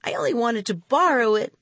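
ordinary delivery

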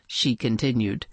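ordinary delivery

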